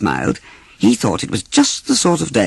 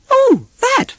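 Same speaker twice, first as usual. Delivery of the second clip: very high, timid little voice; a small unsure piglet puzzling aloud. Acted. Piglet confused by Pooh, very high and timid.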